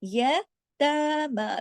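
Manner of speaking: यतामा with the ता held for two counts; यतामा chanted long; drawn-out yatama. Shown as a demonstration. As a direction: stretch यतामा very slowly. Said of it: "ता दो हरकत".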